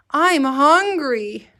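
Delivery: high, slow, whiny hungry voice; teacher modeling a hungry child's whine. Shown as a demonstration. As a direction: stretch it out like a hungry kid. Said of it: "important to use your voice".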